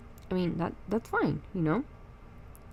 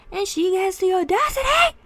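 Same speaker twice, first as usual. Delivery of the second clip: indignant; 9 semitones higher, 9 dB louder than usual. Real, not acted. Indignant rant about friend.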